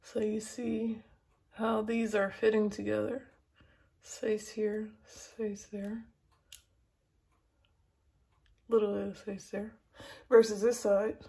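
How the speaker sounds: lip held open by tool; talking with cheek stretched; tool pulling cheek back. Real, not acted. Seen 1: Thin tool hooks lip corner, retracting cheek while she talks; gone ~11-14s.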